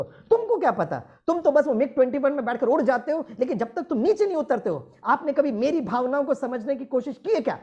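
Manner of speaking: imitated wife's high, rapid complaint; scolding wife. Wife standing up to her pilot husband, mock indignant scolding. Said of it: "वो खड़े होकर कहती है अपने भाई".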